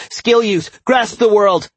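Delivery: high, loud, urgent battle shout; alarmed cry. A narrator's reading. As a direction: yell it out in alarm. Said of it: "Caden cried in alarm".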